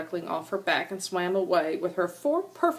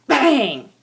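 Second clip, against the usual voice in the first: shouted, punchy bang; loud bang; 6 semitones higher, 11 dB louder than usual. A narrator's reading. Reading the crash to the children, loud bang. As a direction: slam the word out. Sound word in story.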